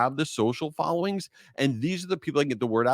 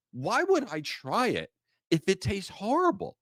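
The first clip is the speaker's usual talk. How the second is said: incredulous; 7 semitones higher than usual. Retold or imitated. Reacting to friend offering bad food.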